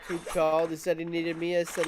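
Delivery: usual speaking voice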